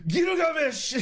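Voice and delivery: high, loud yelled Gilgamesh!; shouted name. Retold or imitated. Streamer imitating a meme guy's yell. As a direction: belt out Gilgamesh! like the meme guy. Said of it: "the video of the guy saying".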